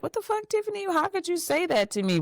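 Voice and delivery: high-pitched offended confrontation, imitated; mock outrage. Imagined offended person confronts her.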